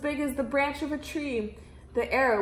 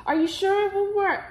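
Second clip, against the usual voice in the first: much higher, louder anxious question; the king anxious it might fail; eager doubting king; 9 semitones higher, 4 dB louder than usual. A narrator's reading. King asking the magicians anxiously, higher.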